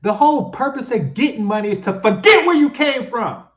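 high, loud exasperated outburst; heated retort. Real, not acted. Commentator answering the clip about money, loud exasperated retort.

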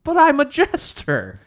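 very high, indignant complaint; outraged whine. Real, not acted. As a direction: wail it in mock outrage. Repeated protest, very high.

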